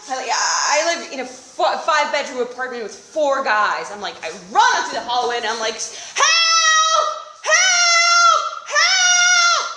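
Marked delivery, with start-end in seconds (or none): 5.7-9.7 high desperate screams for help; shouting for help. Retold or imitated. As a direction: yell help at the top of your lungs.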